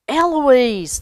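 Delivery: calls out the cat's name loudly; shouting. A narrator's reading. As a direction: shout for the cat across the yard. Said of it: "the boy was shouting".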